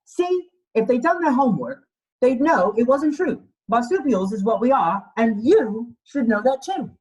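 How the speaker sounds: a put-upon koala protesting his name in rhyme; mildly voiced cross little koala; indignant koala. Koala character lines in picture book.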